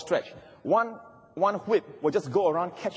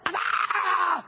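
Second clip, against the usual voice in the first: Bruce Lee cry. Retold or imitated. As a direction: let out a martial-arts war cry. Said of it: "like a Bruce Lee cry".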